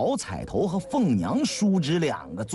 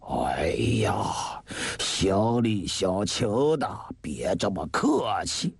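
put-on low, slow old man's protest; deep, weary, neighbourly reassurance; ailing old gentleman; 8 semitones lower, slower than usual. A narrator's reading. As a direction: wave off the courtesy in an old man's voice. Sick host politely declines gift.

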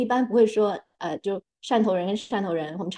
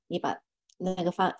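usual speaking voice

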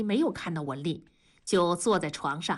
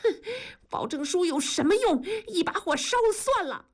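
high-pitched resentful outburst; scoff, then climb high in resentment; spiteful; 11 semitones higher than usual. A narrator's reading. Wife threatens to burn the written pledge, spiteful. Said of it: "恨恨地说".

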